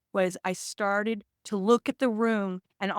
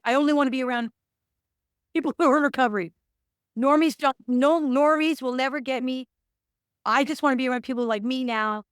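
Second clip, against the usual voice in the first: raised, louder insistent voice, as if pleading her case; higher, louder retold outburst of early-recovery conviction; fervent insistence; 5 semitones higher, 5 dB louder than usual. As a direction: loudly insist only other addicts understand her. Retelling early recovery zeal.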